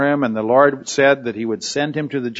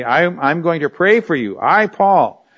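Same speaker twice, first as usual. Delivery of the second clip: higher, insistent declaration; Paul, very emphatic; 4 semitones higher than usual. Acted. Teacher voicing Paul's promise to pray, very emphatic. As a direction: punch I and Paul as a personal pledge. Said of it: "he's very emphatic".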